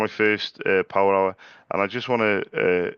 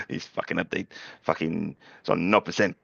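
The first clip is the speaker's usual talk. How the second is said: a hint of his mate's accent, a bit lower; Braxton impression; 3 semitones lower than usual. Messaging Braxton, lightly mimicking his voice. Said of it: "that's your accent, bro".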